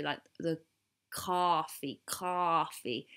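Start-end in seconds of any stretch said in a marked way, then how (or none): 1.1-3.1 coffee with an American open "ah"; American accent. Shown as a demonstration. British vlogger practising coffee, American accent. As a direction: stretch coffee as "cahfee", American style. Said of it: "you have to do, like, the O".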